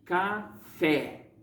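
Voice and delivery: Café split slowly, with the final FÉ hit hard; stress on the tonic syllable. Shown as a demonstration. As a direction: say Café leaning firmly on the last syllable, fé. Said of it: "eu fiz uma pronúncia mais forte".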